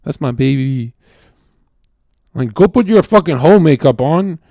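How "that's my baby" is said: gentle affectionate murmur; tender. Retold or imitated. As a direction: coo it like petting her head. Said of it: "pet their head and you go".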